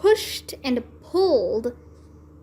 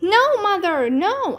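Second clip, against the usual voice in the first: high pleading shout; 7 semitones higher than usual. A narrator's reading. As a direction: shout no to stop her. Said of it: "I shouted".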